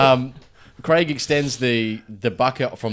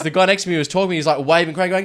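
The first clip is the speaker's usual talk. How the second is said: usual speaking voice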